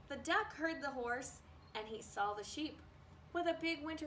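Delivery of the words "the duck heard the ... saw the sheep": ordinary delivery, nothing marked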